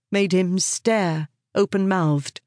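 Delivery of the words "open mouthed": usual speaking voice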